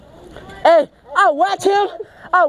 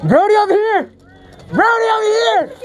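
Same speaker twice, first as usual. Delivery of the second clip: calling out; 3 semitones higher than usual. Real, not acted. Player telling team where Brody is, calling out. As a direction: holler the spot across to teammates.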